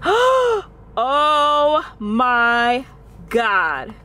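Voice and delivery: gasp, then stretch it in disbelief; excited shock. Real, not acted. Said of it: "I'm so excited right now".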